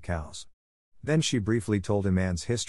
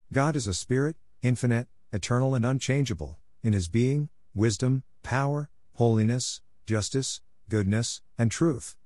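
rattled off promptly like a learned catechism; glib recitation; 4 semitones higher than usual. A narrator's reading. Young girl glibly reciting the catechism to Marilla. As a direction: recite it pat, as if by rote. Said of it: "responded Anne promptly and glibly".

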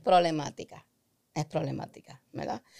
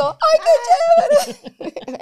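"Ay, qué chévere" stretched slow, much higher and louder; gushing delight; 9 dB louder, much slower than usual. Shown as a demonstration. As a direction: overflow with joy at the gift. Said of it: "es como cuando yo digo".

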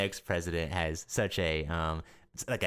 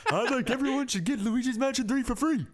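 very high politician impression; Bernie Sanders. Podcaster demands the game be free, high mock-senator voice. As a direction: argue it like an excitable senator, pitched way up. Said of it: "work on our Bernie impression".

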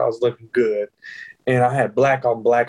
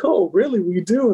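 insistent friend; 7 semitones higher than usual. Retold or imitated. Voices friend insisting once more.

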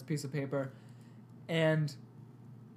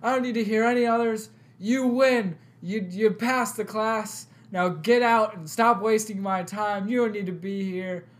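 higher and louder, thrilled praise; excited professor; 6 semitones higher, 8 dB louder than usual. Retold or imitated. Professor praising the winning student, excited and loud. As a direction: gush over the winner. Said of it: "the professor's like".

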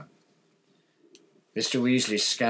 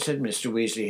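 ordinary delivery, nothing marked

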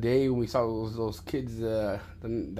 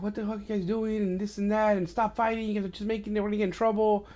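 high, softer imitation of mom scolding; pitched-up retold nagging, not shouted; scolding mother; 8 semitones higher, 4 dB quieter, faster than usual. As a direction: mimic a fed-up mother breaking up a fight. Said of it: "your grandma comes out, my mom".

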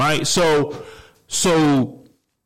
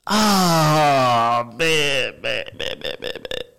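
overcome, fading sigh; much slower than usual. Real, not acted. Overcome after peak.

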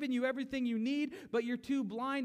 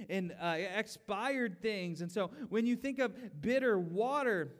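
usual speaking voice

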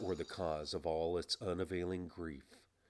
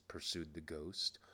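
ordinary delivery, nothing marked

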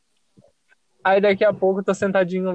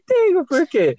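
high-pitched mock innocence; feigned nonchalance; 11 semitones higher, 4 dB louder than usual. Caught crier answering his worried friend, feigned nonchalance. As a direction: play innocent, as if nothing happened. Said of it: "como se nada tivesse acontecido".

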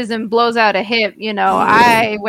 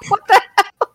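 high, incredulous what the hell; mock stunned reaction; shocked disbelief; 11 semitones higher, 4 dB louder than usual. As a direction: blurt it out in shock. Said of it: "And I'm like".

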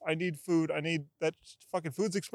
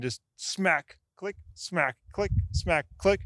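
usual speaking voice

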